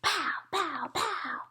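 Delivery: high, soft play-shooting sounds; a toddler pretending to shoot; whispered pow. A narrator's reading. Fudge shoots popcorn, high and hushed. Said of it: "Fudge whispered".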